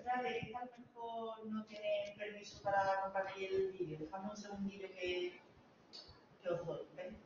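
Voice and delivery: distant, faint off-mic voice; speaking from across the room; far from mic. Real, not acted. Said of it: "Porque yo estoy lejos del micrófono".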